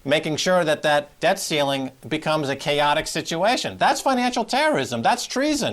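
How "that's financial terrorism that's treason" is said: high-pitched, emphatic denunciation; indignant accusation. Real, not acted. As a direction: raise the pitch sharply and denounce it. Pundit accuses Cantor of treason.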